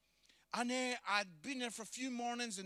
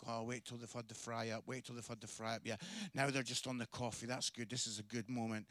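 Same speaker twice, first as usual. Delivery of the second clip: low, hushed inner monologue; quiet, secretive self-coaching; private thinking; 12 semitones lower, 8 dB quieter than usual. Retold or imitated. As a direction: talk yourself through it under your breath. Nervous inner thoughts while waiting to speak.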